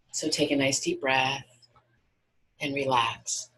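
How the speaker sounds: guided meditation. Acted. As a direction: guide them gently into relaxation, unhurried.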